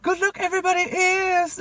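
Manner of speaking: very high, loud squeaky cartoon voice; imitating a Family Guy character cheerfully; Family Guy character impression. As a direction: shriek it like a cartoon driver. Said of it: "that character from, like, Family Guy".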